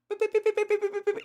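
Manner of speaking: high rapid electronic beeps; playful mimicked gadget beeping; imitated device beeps. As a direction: beep like a ghost meter going off. Imitating ghost detector beeping.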